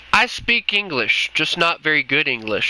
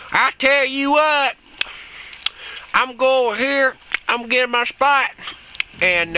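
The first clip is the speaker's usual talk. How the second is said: hillbilly voice; 3 semitones higher than usual. Pretend. Streamer playing a tank gunner for chat, hammed-up hillbilly Southern voice. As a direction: ham up a backwoods Southern twang. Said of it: "I sound like a hillbilly".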